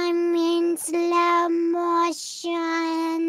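extremely slow, low, softened drawl; slow-motion voice. Pretend. Player pretending slow walking slows speech, slow-motion voice. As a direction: stretch every word out like slowed-down tape. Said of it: "you don't have to talk like that".

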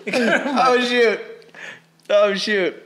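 high, loud yelps from the burn; pained yelp. Real, not acted. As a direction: cry out as the heat hits. Burning mouth from hot pepper.

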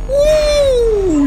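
loud, high victory whoop; excited whoop. Real, not acted. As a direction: let out a triumphant yell. Whoop after surviving a scare.

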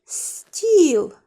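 put-on Стіл said clearly for children to repeat; model word. Shown as a demonstration. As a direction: say it for the class. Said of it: "Вимовте це слово".

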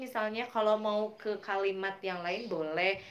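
ordinary delivery

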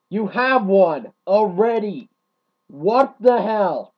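loud, high and slow, with an incredulous angry yell; exasperated shout. Real, not acted. Ranting at greedy cheeseburger players, exasperated shout.

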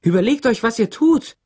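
high, loud desperate cry; screaming. A narrator's reading. Teacher facing the mob wrecking her classroom, screaming. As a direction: shout it high to stop a mob. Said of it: "schrie Miss Catherine".

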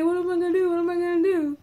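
high, mock-frantic repeated question; frantic worrying. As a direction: imitate someone running around in a panic. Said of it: "running around frantic".

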